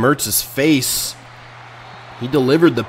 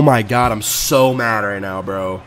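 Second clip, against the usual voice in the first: ordinary delivery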